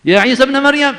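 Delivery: high loud cry "Ya Isa bin Maryam"; loud call. Retold or imitated. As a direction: call out to Isa across a crowd. Followers calling Jesus by name. (seruan keras "Ya Isa bin Maryam")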